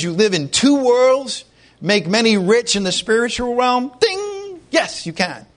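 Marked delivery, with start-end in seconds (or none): 4.0-4.6 a high bright bell-like ding; ding sound effect. Pretend. Preacher signals the right answer to the congregation with a high ding. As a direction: chime it like a quiz show bell.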